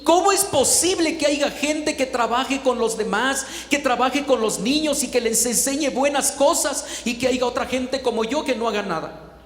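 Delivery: troubled self-reproach. Retold or imitated. Woman confessing her troubled heart to the preacher, self-reproach.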